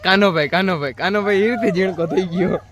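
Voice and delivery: high, loud, sing-song cooing. Real, not acted. Relative cooing at baby Heer in the garden, high baby talk.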